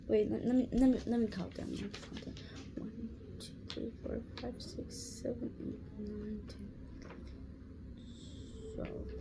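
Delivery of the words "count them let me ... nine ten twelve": counting under her breath; whispered counting. Real, not acted. A girl counting her answers to herself in a whisper. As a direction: barely voice the numbers to yourself.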